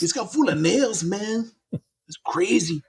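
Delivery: two friends riffing in mock Southern accents. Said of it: "that's closer".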